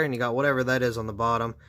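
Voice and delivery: ordinary talk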